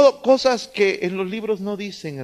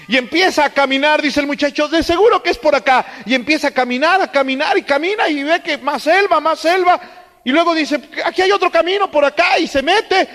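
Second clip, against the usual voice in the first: lively preacher telling the story; animated sermon storytelling; 6 semitones higher than usual. Acted. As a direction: paint the scene for the congregation. Sermón narrado con entusiasmo. (predicador contando la historia con entusiasmo)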